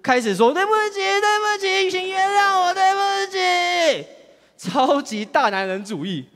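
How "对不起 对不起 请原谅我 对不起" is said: much higher, slowing, hammed-up crying; mock sobbing apology. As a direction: beg forgiveness in a mock wail. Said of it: "突然变成大哭".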